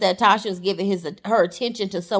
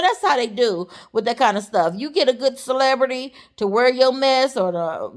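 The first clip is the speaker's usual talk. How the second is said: normal talking voice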